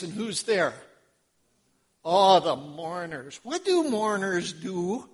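ordinary delivery

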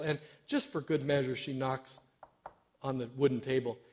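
usual speaking voice

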